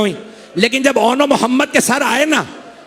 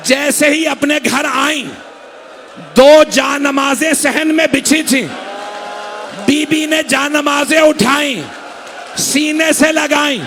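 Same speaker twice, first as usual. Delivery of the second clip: full-force majlis recitation; 4 semitones higher, slower than usual. Acted.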